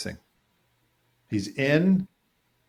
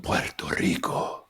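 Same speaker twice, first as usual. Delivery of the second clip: slow, lower, hammed-up Spanish accent; 4 semitones lower, slower than usual. Host playfully echoing the island's name, exaggerated Spanish accent. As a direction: draw out Puerto Rico with a Spanish accent.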